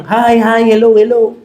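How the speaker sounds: mock bubbly child greeting everyone; light imitation of an eager kid saying hi; chirpy extrovert kid. Preacher imitating an extroverted child greeting people, mock bubbly. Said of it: "may extroverted".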